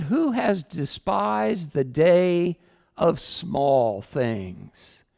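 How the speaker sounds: drawn out, each word weighed; solemn drawn-out question; slow scripture read. Shown as a demonstration. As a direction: read it slowly like a prophet's question. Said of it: "quoting Zechariah with emphasis".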